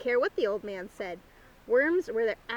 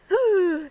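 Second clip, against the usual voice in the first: startled gasp; 7 semitones higher, 9 dB louder than usual. A narrator's reading. Hens startled by fox cry.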